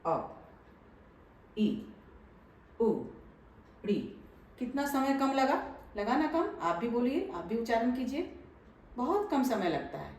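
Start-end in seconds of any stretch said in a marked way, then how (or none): 0.1-4.0 अ, ई, उ, री each clipped very short; short vowel demo. Shown as a demonstration. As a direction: say अ, ई, उ, री quick and short, one by one. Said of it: "देखिए इसका उच्चारण समझिए ध्यान से".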